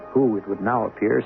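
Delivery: ordinary talk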